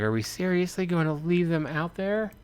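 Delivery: high disbelieving woman. Acted. Anna protesting.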